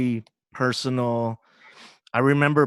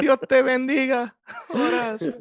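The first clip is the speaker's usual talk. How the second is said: high-pitched grandmotherly blessing; abuelita voice; 13 semitones higher than usual. Acted. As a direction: bless them like a little Spanish-speaking granny. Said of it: "I'll do the abuelita".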